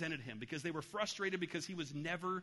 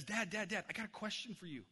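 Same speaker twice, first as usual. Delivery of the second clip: put-on eager little boy; 6 semitones higher than usual. Boy voiced in a story.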